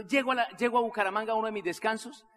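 usual speaking voice

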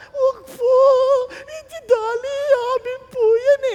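put-on high, loud sobbing plea of a child; crying plea. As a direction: beg through tears not to take the lamb. Poor man's child pleading, crying. (umiiyak na pagmamakaawa ng bata)

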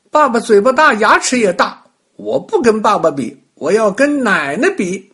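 put-on high, loud child's protest; little boy objecting. A narrator's reading. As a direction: argue back as a small boy.

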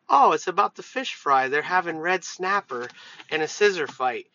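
ordinary talk, nothing marked

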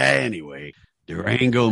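ordinary talk

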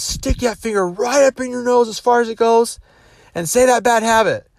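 high, loud hyped-up command; hyped seminar leader. Retold or imitated. Tony firing up ten thousand people, hyped command. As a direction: pump up the whole arena.

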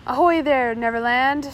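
loud hail across to the other ship; shouted hail. A narrator's reading. Pirate hailing the Neverland, loud shout. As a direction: call out loud over the water. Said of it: "shouted Smee".

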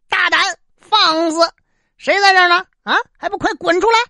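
high, loud, fast commanding bellow; mock imperial roar. Emperor barks at the minister hiding under the table, mock furious roar. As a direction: feign a furious emperor's shout. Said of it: "故意大喝一声".

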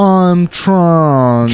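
put-on old New York or Boston money accent; Boston Brahmin accent. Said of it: "They have that accent".

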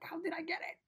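high-pitched, bewildered "how did I get it"; baffled question. Retold or imitated. Asking himself how he caught the virus, high and baffled. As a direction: squeak it out, totally confused.

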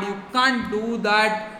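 ordinary delivery

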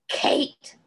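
unvoiced, choked, breathy call; a wounded man forcing out a name; choked out weakly. A narrator's reading. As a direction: gasp the name out, weak and distant. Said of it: "choked out, weak and distant".

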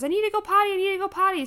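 put-on high, loud, insistent little boy; urgent toddler. Mom imitating her son at the pool, loud and high. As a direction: beg like a kid who has to go right now. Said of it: "and Clayton goes".

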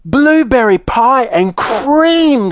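high, drawn-out exclamation of delight; excited shout. Acted. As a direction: call it out slowly, thrilled, pitched high. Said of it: "shouted Violet".

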